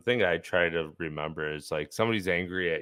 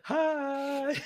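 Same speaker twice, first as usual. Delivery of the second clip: mock peppy hi. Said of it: "super over, like, peppy".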